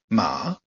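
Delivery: MA dipping then rising; third tone. Shown as a demonstration. Teacher gives the word for horse, dipping tone. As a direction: drop "Ma" and bring it back up. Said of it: "celui qui descend pour remonter".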